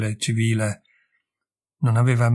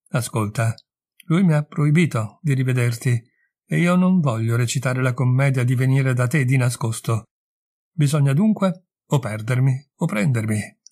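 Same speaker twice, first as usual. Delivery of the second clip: plain reading voice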